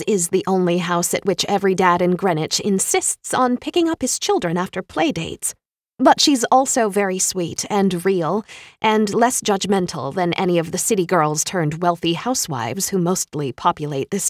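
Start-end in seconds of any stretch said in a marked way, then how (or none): none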